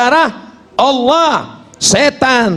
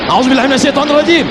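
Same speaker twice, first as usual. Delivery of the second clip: "A'udzu billahi minasy syaithanir rajim" spat out fast and higher; angry rushed recitation; 6 semitones higher, much faster than usual. Shown as a demonstration. Preacher showing the prayer said in anger, fast and heated. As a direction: rattle off the ta'awwudh in a hurry. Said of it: "Kalau sedang marah".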